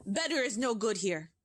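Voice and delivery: witch crying out. Acted. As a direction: rant with disgust at the children. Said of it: "shrieked the Grand High Witch".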